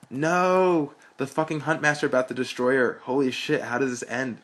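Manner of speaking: high, loud shout that drops back to eager questions; excited shout. A narrator's reading. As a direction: cheer like a friend hooked on the story. Friend cheers the story, clapping.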